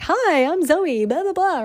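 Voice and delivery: high, slow sing-song hello; exaggerated chirpy greeting, drawn out; mock podcast intro. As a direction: put on a swooping, high intro voice. Imitates her practice podcast intros.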